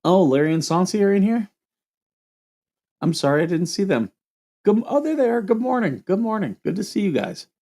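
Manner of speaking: sing-out high, delighted hello; apologetic welcome in a high voice; high-pitched greeting. Real, not acted. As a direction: greet late-noticed friends way up high. Greeting viewers just noticed in chat.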